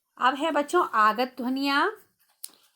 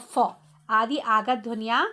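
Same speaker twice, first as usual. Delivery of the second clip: ordinary talk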